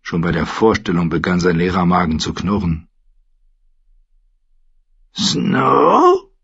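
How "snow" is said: hoher, krächzender Vogelruf; krächzender Rabe. A narrator's reading. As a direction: ein Rabe, der den Namen krächzt. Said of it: "krächzte der Rabe". (hoch gekrächzter Rabenruf)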